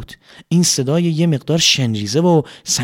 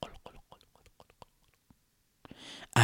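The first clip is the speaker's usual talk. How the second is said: bubbling water; 20 dB quieter, much faster than usual. A narrator's reading.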